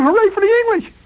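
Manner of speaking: high hollered taunt. Retold or imitated. The kid taunting Riley across the pickler, hollered high. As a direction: fling the phrase at him, loud and high.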